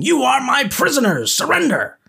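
high, loud commanding shout; yelling. Acted. Deputy charging in on the Rangers, yelling. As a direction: yell it out like a charge at armed men. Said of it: "ran in yelling".

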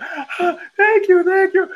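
high, hammed-up performer's thank you; imitating a creepy comedian thanking the front row; mock stage thanks. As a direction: gush thanks like a showman on stage. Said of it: "does all the same hand gestures".

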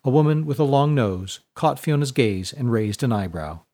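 ordinary delivery, nothing marked

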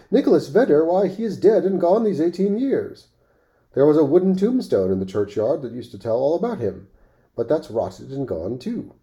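put-on high, thin old man's pipe; thin piping voice. A narrator's reading. Old man tells Rip his friend is dead, thin and piping. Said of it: "in a thin, piping voice".

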